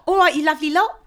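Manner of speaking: loud, high Cockney greeting; hammed-up London accent. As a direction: belt out the London hello. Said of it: "I don't know why I'm talking like that".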